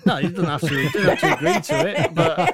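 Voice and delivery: laughing loud and high. Real, not acted. Host to guest after offer of a drink, laughing. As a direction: laugh it off while backing down.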